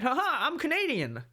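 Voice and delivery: high, hammed-up Canadian accent; squeaky South Park Canadian voice; mock Canadian. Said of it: "they always have the voice".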